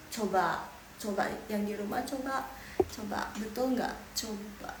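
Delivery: ordinary talk, nothing marked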